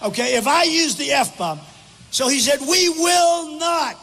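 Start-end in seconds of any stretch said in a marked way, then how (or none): 2.6-4.0 high, drawn-out mock refusal; hammed-up indignant declaration; imitating crazed outrage. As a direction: act out someone going crazy with defiance. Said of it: "This is the way he said he was going crazy".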